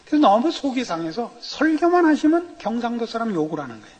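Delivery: ordinary delivery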